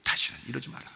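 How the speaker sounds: soft, hushed, tender warning; gentle admonition. Acted. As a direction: say it quietly and kindly, without force. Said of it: "예수님이 부드럽게 얘기했을 거예요".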